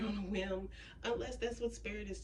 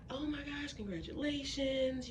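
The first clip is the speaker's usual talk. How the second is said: excited congratulations in a high, raised voice; تهنئة متحمسة; 8 semitones higher than usual. Acted. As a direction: gush like a thrilled well-wisher. Voicing people congratulating the new homeowner.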